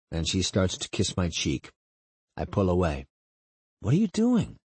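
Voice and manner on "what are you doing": high, startled question. Acted. Narrator pulls away from the kiss, startled. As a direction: pitch leaps up as you pull away.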